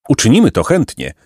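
usual speaking voice

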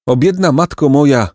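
plain reading voice

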